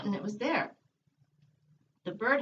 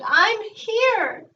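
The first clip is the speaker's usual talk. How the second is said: high, loud sobbing cry; 10 semitones higher, 8 dB louder than usual. A narrator's reading. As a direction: break down crying the words. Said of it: "Harry sobbed".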